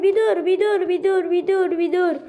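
frantic pleading. Real, not acted. Begging game to hold on.

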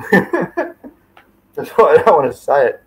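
real laugh breaking into the words. Real, not acted. Host avoiding saying a risky name, laughing.

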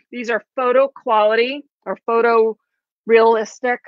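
ordinary talk

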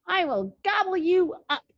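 high, hammed-up troll threat; mean old troll. A narrator's reading. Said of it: "said the mean old troll".

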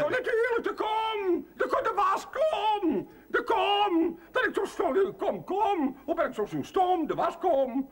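slap your forehead, it was the washbowl all along; excited realisation. Acted. Housekeeper scolding herself, excited eureka about the washbowl.

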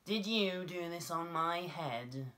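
high, slow, accusing mole voice; little mole asking. A narrator's reading. Mole questions the passing dove, slow high accusing voice. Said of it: "he asked the dove".

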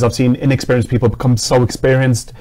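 ordinary delivery

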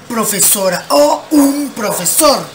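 normal talking voice

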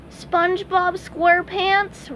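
high, slow cartoon sponge voice; a frazzled, squeaky young fry cook; harried fry cook. A narrator's reading. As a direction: stretch his own name out, flustered. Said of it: "replied the harried fry cook".

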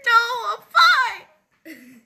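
high, loud, cracking denial; sudden loud squeak of protest; strained outburst. Acted. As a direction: blurt it out, voice breaking. Denies eyes popping, voice breaks.

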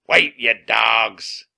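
loud, very slow, stretched shout; captain's yell. A narrator's reading. Captain wades after the rowboat, yelling slowly and loudly. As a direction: bellow it at deserters. Captain yells at deserting crew.

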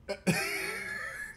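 soft, high wheezy chuckle; short quiet giggle to himself; amused chuckle. Real, not acted. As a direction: let out a quiet real laugh. Host amused by the show.

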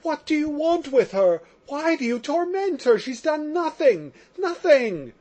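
high anguished cry, pleading. A narrator's reading. Prisoner crying out to the lawyers, high anguished plea. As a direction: shout it up high, begging them to stop. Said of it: "crying out to them".

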